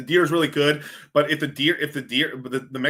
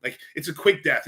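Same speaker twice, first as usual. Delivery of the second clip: usual speaking voice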